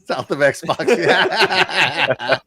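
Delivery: loud, laughing repeat. Real, not acted. Host enjoys friend's pun, laughing.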